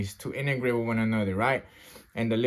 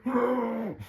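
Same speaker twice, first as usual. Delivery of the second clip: high, sucking gasp; imitated strained inhale; 9 semitones higher, 4 dB quieter than usual. Man recounting breathing through his shirt, imitated gasp. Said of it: "I try to inhale it".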